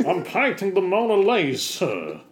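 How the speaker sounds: high, loud over-the-top artist's cry; hammed-up painter getting carried away; voice gone strange. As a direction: wail it grandly in a runaway comic voice. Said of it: "what happened to my voice".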